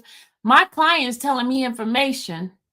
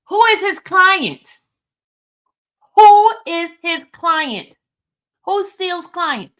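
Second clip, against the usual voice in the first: high, loud questions, easing off at the end; insistent quizzing; 7 semitones higher, 6 dB louder than usual. Real, not acted. Host pushing listeners to name the client, insistent and high. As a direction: press the audience hard for the answer. Pressing listeners to work out answer.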